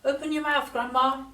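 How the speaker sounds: high, urgent grandson voice; crying out. A narrator's reading. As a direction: call to her high, without shouting. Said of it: "he cried".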